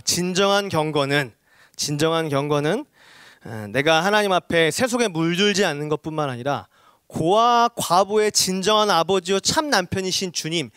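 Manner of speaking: ordinary talk, nothing marked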